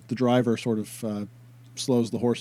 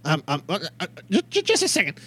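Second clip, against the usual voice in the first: nervous gnome stammer; 4 semitones higher than usual. Acted.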